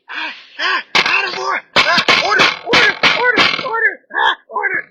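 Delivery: loud, high, frantic calls for order; mock gavel shouting. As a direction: yell order over the noise like a flustered chair. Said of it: "they're like".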